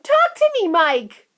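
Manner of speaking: very high, loud desperate cry; cried. A narrator's reading. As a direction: plead with the boy in panic. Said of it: "cried Missus Teavee".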